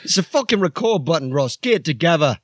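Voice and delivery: high, shouty bossy bloke yelling at the lads; Archie character voice. Acted. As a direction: bark orders at the crew in a high put-on voice. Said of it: "This is Archie".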